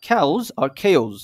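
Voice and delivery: Chaos first as "khaus", then as "khe-oz"; two pronunciations. Shown as a demonstration. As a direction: give Chaos both ways, slowly, "khaus" or "khe-oz". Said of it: "দুইভাবে বলা যায়".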